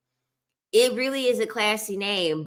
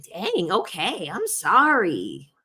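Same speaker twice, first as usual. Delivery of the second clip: slow, drawn-out wavering apology; mock apology; slower than usual. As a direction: apologize with exaggerated, stretched words.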